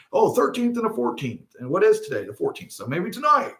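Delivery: lightly voiced eager skywatchers reckoning; hopeful people. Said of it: "They're like".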